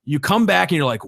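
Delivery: normal talking voice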